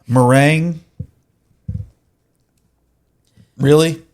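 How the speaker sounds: loud, incredulous disgust; disgusted disbelief. Real, not acted. Disgusted at meringue.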